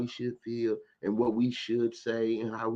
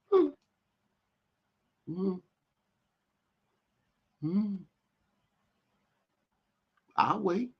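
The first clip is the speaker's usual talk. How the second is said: sarcastic waiting; 8 semitones higher than usual. Real, not acted.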